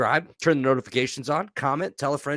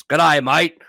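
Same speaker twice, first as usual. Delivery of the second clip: put-on louder, higher Aussie greeting in an Australian accent; a cheesy Australian accent thrown out for laughs; 4 semitones higher, 6 dB louder than usual. Podcast host imitating CNN Australia presenters, hammed-up Australian accent. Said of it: "a bunch of fucking awesome accents".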